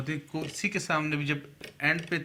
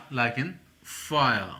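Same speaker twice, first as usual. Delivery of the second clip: "fire" stretched very slowly as "faa-ya"; slow demo; much slower than usual. Shown as a demonstration. Teacher slowly drilling "fire" for learners. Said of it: "इससे आया".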